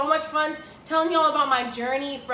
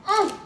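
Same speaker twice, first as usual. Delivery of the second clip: short, higher mm of pleasure; savouring hum; 5 semitones higher than usual. Real, not acted. Cook tasting her jambalaya, savouring hum. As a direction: hum at the first taste.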